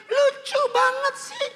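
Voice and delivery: very high, drawn-out coo; hammed-up squeal over something adorable; gushing woman. Woman seeing a small child, squealing mock adoration.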